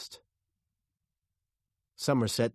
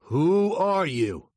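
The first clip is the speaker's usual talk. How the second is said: loud, slow, raised question; shouted demand; 8 semitones higher, 8 dB louder, slower than usual. A narrator's reading. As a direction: interrogate at gunpoint. Armed detective questions driver.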